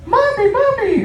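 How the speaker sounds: put-on high squeaky little kid calling; child voice. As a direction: pitch way up like a small child. Kid voiced in stand-up bit.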